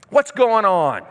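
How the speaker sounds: normal talking voice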